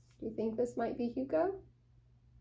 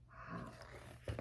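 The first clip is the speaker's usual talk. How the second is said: awed whisper; 20 dB quieter than usual. Acted. Awed at the picture.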